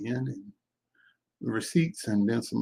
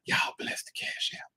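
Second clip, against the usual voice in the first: hushed, whispered aside; soft unvoiced stage whisper; playful whisper; 7 dB quieter than usual. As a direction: whisper it like a secret blessing. Playful aside about donations.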